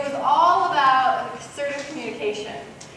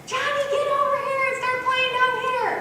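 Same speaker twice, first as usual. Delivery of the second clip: mock yelling at a child; 11 semitones higher than usual. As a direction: imitate a fed-up parent shouting across the room. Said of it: "if I start screaming at Johnny".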